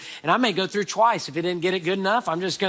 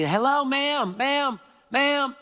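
high, gentle calling out to a stranger; 9 semitones higher, much slower than usual. Retold or imitated. Pastor calling to an old lady on the street, high and gentle. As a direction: sing out ma'am politely, pitched high.

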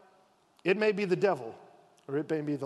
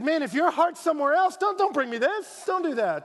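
higher, louder indignant protest; mock angry girls; 9 semitones higher, 5 dB louder than usual. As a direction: play the offended girls, heated. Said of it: "it got angry in there".

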